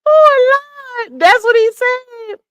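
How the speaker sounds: crying with laughter; real helpless laughter after her own impression; laughing hard. Real, not acted.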